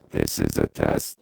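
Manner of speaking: very low, robotic pitch-shifted voice. Shown as a demonstration. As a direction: drop the pitch deep and robotic. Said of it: "From thirty-six semitones down".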